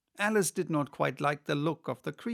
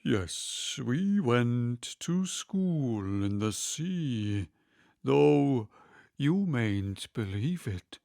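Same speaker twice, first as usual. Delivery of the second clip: slow, hollow, gloomy turtle voice; resume the sad tale ponderously; mournful Mock Turtle; 2 semitones lower, much slower than usual. Mock Turtle resumes his story.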